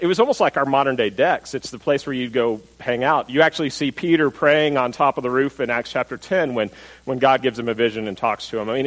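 ordinary delivery

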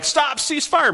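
high-pitched mock battle cry to stop shooting; urgent call. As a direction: call out over the gunfire to halt it. Soldier calling out in battle.

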